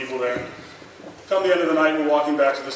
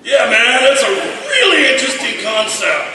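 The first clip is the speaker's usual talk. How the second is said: put-on much higher, louder, laughing drunken agreement; drunk guy; 10 semitones higher, 9 dB louder, slower than usual. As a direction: slur through the praise, laughing, propped on a wall. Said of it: "leaning up against the wall going".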